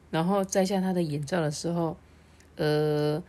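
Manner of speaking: ordinary delivery, nothing marked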